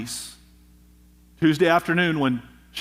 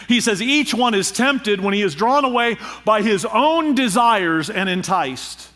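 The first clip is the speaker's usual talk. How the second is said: higher, louder preaching drive; full-force preaching; 6 semitones higher, 3 dB louder than usual. Acted. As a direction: preach it hard to the congregation.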